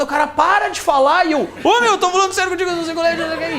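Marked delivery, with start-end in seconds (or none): 0.3-1.2 loud, high mock robber outburst; acted burst of irritation; exasperated order. Robber snapping at pleading victim. 1.6-3.6 fast, high-pitched panicky plea; comic frantic insistence, acted out; nervous, scared stiff. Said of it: "E eu nervoso, cagado".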